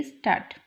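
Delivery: स्टार्ट ending in a crisp ट; German final t. Shown as a demonstration. Teacher answering her own question with स्टार्ट ending in ट. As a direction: say स्टार्ट with a hard final ट, not ड. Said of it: "तो इसकी sound ड ही जाएगी, अ, ट जाएगी".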